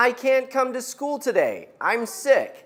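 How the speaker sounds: teacher showing students how to say it; model sentence. Shown as a demonstration. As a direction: say it clearly for the class to repeat. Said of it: "this side will say".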